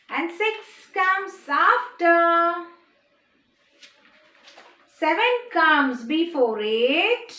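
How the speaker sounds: very slow sing-song, words stretched; slow rhythmic teacher chant; number rhyme recitation. Shown as a demonstration. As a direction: lead the class in a drawn-out chant. Recites the poem for children.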